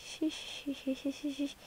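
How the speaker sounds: soft, voiceless shushing; whispered shh while sneaking in. Real, not acted. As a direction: hush yourself while sneaking. Sneaking into neighbor's house.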